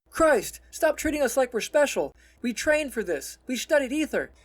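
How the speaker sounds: high, loud exasperated tirade; angry rant. A narrator's reading. Simon rails at his cautious friend, angry rant. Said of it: "Simon rants".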